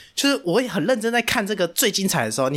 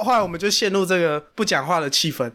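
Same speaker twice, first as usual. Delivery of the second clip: ordinary talk, nothing marked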